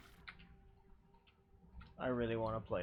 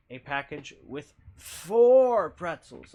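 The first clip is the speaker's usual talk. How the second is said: slow, much louder build; excited reveal; 4 semitones higher, 17 dB louder, much slower than usual. Retold or imitated. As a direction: draw it out like big news.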